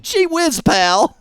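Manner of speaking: high, squeaky gee whiz. Acted. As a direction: squeak like a nervous kid. High voiced exclamation.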